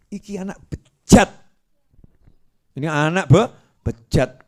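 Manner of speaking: loud, scolding cry; indignant outburst. Acted. Preacher condemning a stingy son, loud outrage. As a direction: denounce the son loudly.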